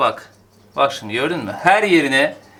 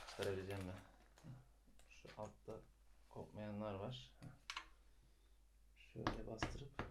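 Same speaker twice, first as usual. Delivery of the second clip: much softer, keeping quiet for a sleeper; hushed; 2 semitones lower, 24 dB quieter than usual. Real, not acted. Morning vlogger hushing so Ezgi can sleep, very soft. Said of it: "Çok bağırarak konuşamıyorum".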